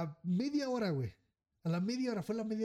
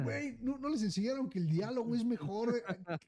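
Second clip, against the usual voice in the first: mock mediator; 6 semitones higher than usual. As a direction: lecture them mockingly about dialogue. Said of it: "Yo así de". (músico que sermonea burlón, con voz aguda y lenta)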